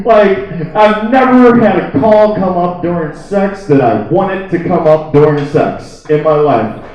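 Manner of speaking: usual speaking voice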